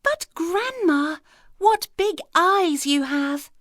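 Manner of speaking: put-on a child staring, amazed; the girl marvels at grandma's eyes, high and slow; wondering little girl. A narrator's reading.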